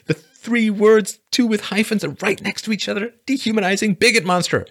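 high, loud squeaky incredulous voice; high mock squeal. Mocking a celebrity's tweet to viewers, high and squeaky. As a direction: squeal the words in disbelief.

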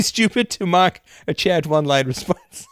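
words breaking up in laughter; laughing. Real, not acted. Streamer reading a viewer's chat, laughing through it.

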